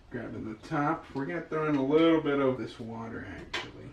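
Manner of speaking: ordinary talk, nothing marked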